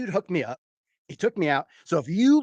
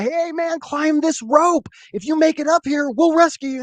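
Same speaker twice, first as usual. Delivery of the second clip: high, acted holler to someone below; calling out; 11 semitones higher, 3 dB louder than usual. Helicopter crew to the starving man below, calling out. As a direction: pitch it way up and call down.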